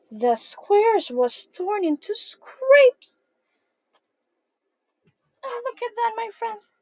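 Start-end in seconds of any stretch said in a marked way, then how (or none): none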